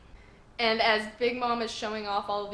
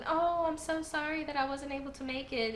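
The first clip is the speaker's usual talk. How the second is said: higher-pitched mock-sweet apology; apologetic, lighthearted; 6 semitones higher than usual. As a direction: imitate a light, sympathetic sorry. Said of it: "acting all apologetic and lighthearted".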